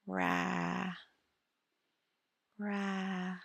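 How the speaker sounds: usual speaking voice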